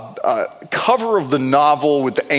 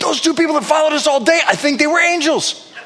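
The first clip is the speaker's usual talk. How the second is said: excited wife; 11 semitones higher than usual. Retold or imitated. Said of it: "she finishes my sentence and said".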